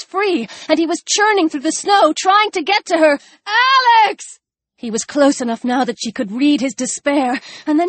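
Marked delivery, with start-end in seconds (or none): 3.5-4.4 high-pitched, drawn-out desperate cry; desperate call. A narrator's reading. Chris struggles through snow to reach Alex, desperate high cry.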